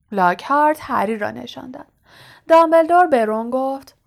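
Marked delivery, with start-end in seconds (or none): none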